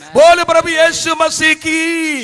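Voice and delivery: loud, high, drawn-out call to the crowd; call and response. Acted. Preacher calls the congregation to shout, loud and high. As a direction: rouse the hall to answer back.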